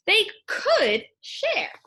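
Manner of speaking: slow, high finish. A narrator's reading. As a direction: linger on the last words. Story ending line.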